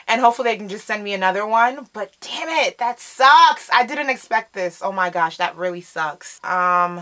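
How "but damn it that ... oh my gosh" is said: usual speaking voice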